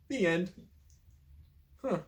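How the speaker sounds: usual speaking voice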